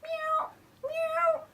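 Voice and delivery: very high, thin kitty meows; cat meow. Retold or imitated. Student obeying yogi's exercise, high little meows. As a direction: mimic a cat in a tiny high voice.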